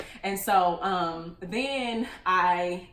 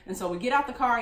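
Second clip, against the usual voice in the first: ordinary delivery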